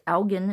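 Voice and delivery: ELGIN with g as in get; hard-g ELGIN. Shown as a demonstration. Woman telling what she learned from her mom, hard-g ELGIN. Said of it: "I didn't know it was pronounced".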